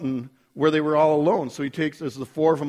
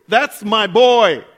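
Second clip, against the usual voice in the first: God speaking over the backyard, booming and proud; 4 semitones higher, 8 dB louder than usual. Retold or imitated. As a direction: boom it out like a proud father in the sky. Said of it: "you hear this voice".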